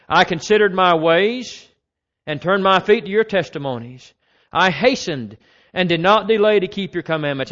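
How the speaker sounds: loud, measured psalm reading; scripture reading. Acted. Preacher reading Psalm 119 to the congregation, loud and measured.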